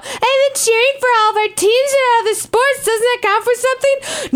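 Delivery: high, whiny mock complaint. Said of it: "in a whiny little bitch voice".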